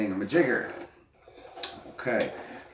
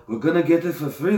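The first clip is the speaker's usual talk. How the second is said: louder, higher mock Bernie; hammed-up Bernie promise; mock Bernie Sanders stump line; 7 semitones higher, 7 dB louder than usual. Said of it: "Bernie Sanders".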